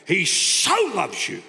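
slow, "so" leaned on; slow, weight on "so loves"; slow, leaning on "so"; stress on so. Shown as a demonstration. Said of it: "He doesn't love you".

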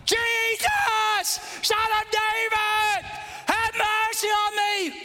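high, sustained bellow; drawn-out yelling. Acted. Blind man told to shut up, yelling longer to Jesus. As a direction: stretch every phrase into a long, high shout. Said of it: "Blind man yelling out".

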